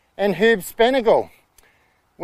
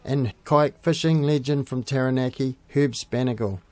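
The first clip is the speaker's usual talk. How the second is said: low, measured travel-show narration; documentary voiceover; 6 semitones lower, 5 dB quieter than usual. Acted. Host telling viewers about the hatchery visit, measured voiceover. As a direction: guide viewers through the trip in an even read.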